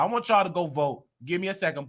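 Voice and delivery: ordinary talk, nothing marked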